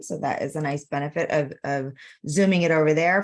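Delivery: ordinary talk, nothing marked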